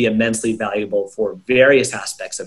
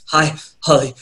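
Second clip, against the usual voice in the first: winded, broken-up greeting with gaps between words; demonstrating breathless, halting speech; out of breath; 4 semitones higher than usual. Shown as a demonstration. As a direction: puff out each word separately, as if gasping mid-workout. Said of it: "out of breath like that".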